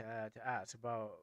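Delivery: usual speaking voice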